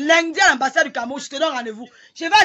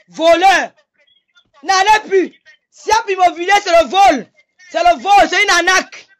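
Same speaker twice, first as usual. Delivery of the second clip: furious shout; 2 semitones higher, 5 dB louder than usual. Real, not acted. Woman denouncing her accuser to her viewers, shouting in fury. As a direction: scream the accusation of theft at him.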